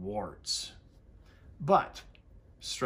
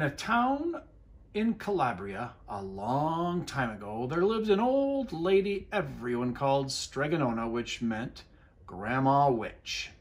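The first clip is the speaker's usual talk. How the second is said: lively read-aloud storytelling; reading a picture book to children; story narration; 3 semitones higher, 3 dB louder than usual. A narrator's reading. Teacher reading to the class, lively storybook voice.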